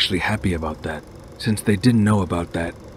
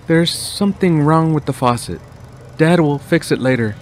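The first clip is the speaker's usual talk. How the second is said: higher, louder mother voice; mom voice; 9 semitones higher, 5 dB louder than usual. Acted. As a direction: explain like a nervous mom. Mom explains faucet.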